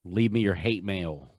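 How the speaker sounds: usual speaking voice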